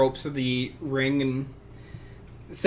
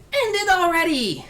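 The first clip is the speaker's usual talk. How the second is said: put-on much higher, louder child's yell; very young voice yelling; 5 dB louder than usual. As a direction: shout it like an impatient little kid. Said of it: "very young voice, uh, abnormally young, yelling out".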